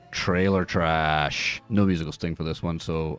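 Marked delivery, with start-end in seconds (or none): 0.1-1.6 announcer voice. Pretend. Announcing the segment title.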